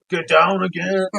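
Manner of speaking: Arnold Schwarzenegger. Retold or imitated. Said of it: "Arnold Schwarzenegger".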